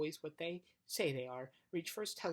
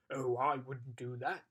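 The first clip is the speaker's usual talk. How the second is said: thoughtful giant voice; 3 semitones lower, 6 dB louder, slower than usual. Acted. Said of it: "said the giant thoughtfully".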